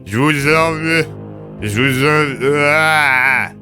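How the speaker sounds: high, slow, strained garble; loud frustrated drugged moan; drugged slurring. Acted. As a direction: force mangled words out, ending in a groan. Drugged sergeant struggles to curse.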